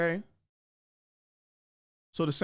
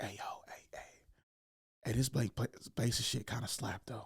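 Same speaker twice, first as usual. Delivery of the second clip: hushed, softer guilty-pleasure whisper; secret whisper; 8 dB quieter than usual. Retold or imitated. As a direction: whisper it like a confession to your roommate. Roommates sharing a guilty secret.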